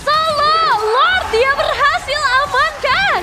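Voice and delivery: screaming, drawn-out savage call; esports caster. Real, not acted. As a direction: belt it out slowly over the crowd.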